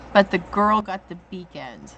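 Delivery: usual speaking voice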